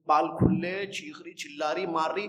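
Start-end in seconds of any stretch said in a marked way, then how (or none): none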